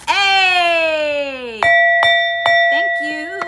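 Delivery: high, loud call of "Hey!"; loud greeting. Real, not acted. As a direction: shout it out to the crowd. Greeting the audience loudly.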